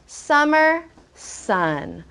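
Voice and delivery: slow, stretched word by word; drawn-out dictation. Shown as a demonstration. Teacher dictating words to write, drawn out slowly. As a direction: say each word slowly for writing. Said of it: "help me write those two words".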